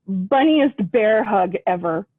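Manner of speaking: plain reading voice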